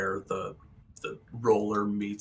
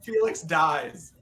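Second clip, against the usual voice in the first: laughing; 7 semitones higher, 6 dB louder, slower than usual. Real, not acted. GM jokingly refuses the voice.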